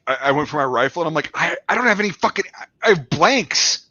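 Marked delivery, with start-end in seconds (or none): 1.3-3.9 higher, stammering mock panic; panicked realization, voice pitched up; acted panic. Soldier finding he only has blanks, acted panic. Acting out panic at big cat.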